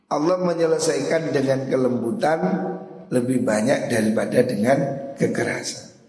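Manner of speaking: usual speaking voice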